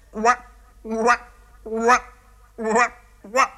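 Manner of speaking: high, separate frog croaks; croaking frogs. A narrator's reading. Frogs insist and keep calling, croaking. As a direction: keep croaking insistently, one syllable at a time. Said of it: "продолжали кричать".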